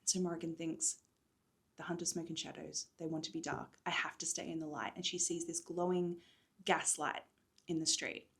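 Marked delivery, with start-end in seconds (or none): 1.8-4.9 hushed frightened thought. Acted.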